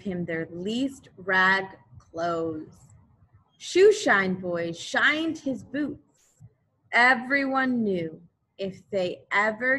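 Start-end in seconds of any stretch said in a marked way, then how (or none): none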